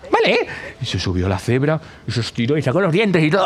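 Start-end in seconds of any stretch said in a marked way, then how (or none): 0.1-0.5 very high little yes; squeaky zebra. Acted. Zebra agrees to climb on the giraffe, squeaky high.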